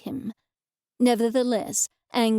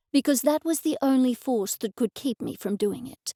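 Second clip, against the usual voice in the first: ordinary delivery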